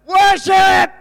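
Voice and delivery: loud, high shout of the word; jubilant cheer. Pretend. Preacher imagining the church cheering like his grandkids, loud and high. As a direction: belt it like a crowd going wild.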